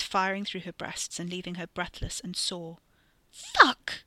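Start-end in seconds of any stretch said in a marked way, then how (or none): 3.3-4.0 high, loud yelp of pain; pained outcry. Acted. Katie reacts to pain shooting through her chest, pained outcry. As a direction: cry out at a sudden stab.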